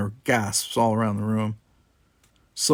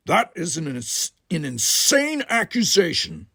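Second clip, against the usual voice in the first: stern Worf; 7 semitones higher than usual. Acted. Worf rebuts accusation.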